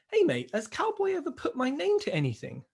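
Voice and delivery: high, put-on voice reading the text; lightly imitated worried tradesman; mock worried mate. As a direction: lightly voice the anxious electrician.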